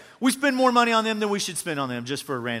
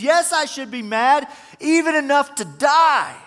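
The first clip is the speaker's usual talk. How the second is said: sulking Jonah; 7 semitones higher, 5 dB louder than usual. Retold or imitated. Sulking Jonah answers God.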